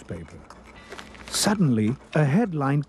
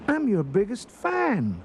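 higher-pitched gushing; excited fan; 8 semitones higher than usual. A narrator's reading. Ralph meeting his favorite singer, excited and high. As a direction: gush it high to your idol.

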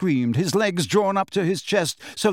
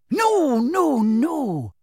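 much higher, gleeful sing-song; Bellatrix's voice; 10 semitones higher, much slower than usual. A narrator's reading. Bellatrix gleefully stopping the Death Eaters, much higher. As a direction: stop them, thrilled. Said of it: "said Bellatrix".

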